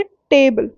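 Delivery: slow table with force on टे, weak बल; stress on first syllable. Shown as a demonstration. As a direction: hit टे in table, let बल fall away. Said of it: "टे बोल रही हूँ मैं, उसमें ज़्यादा फोर्स". (टेबल में टे पर ज़ोर, बल हल्का)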